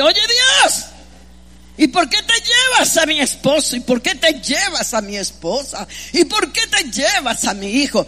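high shouted complaint to God, easing off; mock tantrum. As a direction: imitate a bratty child demanding answers from God. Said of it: "un niño berrinchosos".

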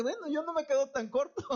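high-pitched quote with a laugh; joking quote. Retold or imitated. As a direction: voice the reader, amused. Jokes in imagined reader's voice. (cita en broma, aguda y risueña)

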